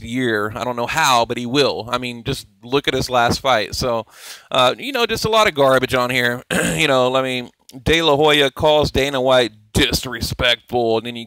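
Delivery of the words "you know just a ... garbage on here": ordinary talk, nothing marked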